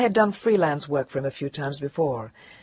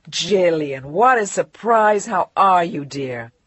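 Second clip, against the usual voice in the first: effusive editor greeting; 6 dB louder, slower than usual. Acted. Editor Angus gushing into the phone. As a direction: boss gushing over a returning writer.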